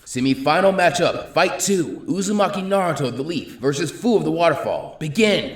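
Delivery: high, loud proctor announcing the bout; announcing the match. Acted. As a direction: proclaim the fight to the crowd.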